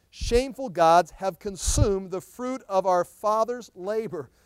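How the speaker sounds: loud, ringing scripture reading; full-voiced preacher proclaiming; preaching at full force. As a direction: proclaim it to the whole hall.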